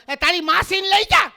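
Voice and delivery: hammed-up Kathiyawadi shout; fearsome Kathiyawadi woman. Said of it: "ખતરનાકમાં ખતરનાક બાઈ હોય તો સૌરાષ્ટ્ર".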